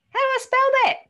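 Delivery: high, loud pleading voice of someone asking; whiny asker. Pretend. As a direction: whine the question up high like a needy colleague.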